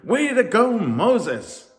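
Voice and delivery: very high, loud cheer. A narrator's reading. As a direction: whoop for the leader. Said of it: "they laughed and they cheered".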